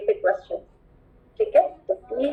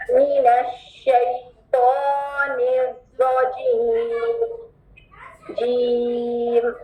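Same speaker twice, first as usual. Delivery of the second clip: drawn-out रजीम; slow recitation; much slower than usual. Shown as a demonstration. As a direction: lengthen रजीम. Recitation demo. (धीमी तिलावत)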